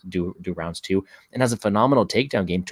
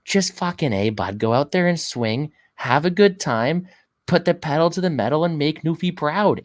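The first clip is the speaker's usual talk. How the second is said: pep talk cheer; 7 semitones higher, 4 dB louder than usual. Pretend. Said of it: "I'll say".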